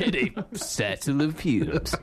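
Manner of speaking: slow, joking trailer announcer; movie guy voice. Acted. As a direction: finish the mock trailer tagline slowly. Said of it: "I love the movie guy voice".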